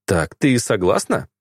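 ordinary delivery

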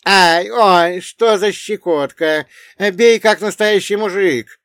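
high, loud taunting yells; dwarf's shouting. Acted. Dwarf dodging slaps, high taunting shouts. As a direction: jeer high and loud under blows. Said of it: "кричал карлик".

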